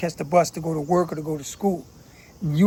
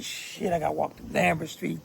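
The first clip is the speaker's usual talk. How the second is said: whispered "shit", then a much softer mutter; worried whisper; 9 dB quieter than usual. Retold or imitated. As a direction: hush it like you're scared someone will hear. Imagined fearful thought walking through gangs.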